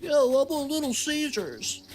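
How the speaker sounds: high silly mock voice; mock annoying coworker. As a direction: play the guy who dares suggest it. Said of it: "He's like".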